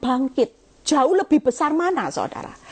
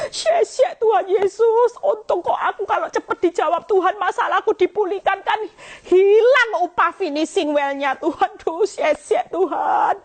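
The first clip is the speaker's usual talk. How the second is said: acted tearful thanks; 6 semitones higher than usual.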